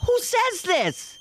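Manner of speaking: very high, stretched disbelief; mock outrage. Comic appalled by remark about her age, high mock outrage. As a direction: shoot up high and draw it out.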